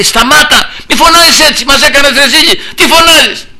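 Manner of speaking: high, urgent rebuke; scolding. Acted. Crowd rebukes the blind man for shouting, high scolding. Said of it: "τον επέπλητον".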